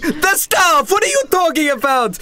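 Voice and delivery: the boss scoffing at the delivery; irate boss. Acted. Boss demands an explanation, high and indignant. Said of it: "he's like".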